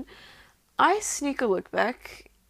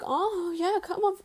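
much higher, moaned encouragement; imitated moaning plea; 9 semitones higher than usual. Narrator urging partner on, high moaning voice. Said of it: "I'm moaning for him".

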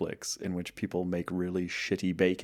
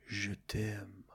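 breathy French whisper; 12 dB quieter than usual. Shown as a demonstration. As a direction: whisper it seductively. Says sexy French phrase.

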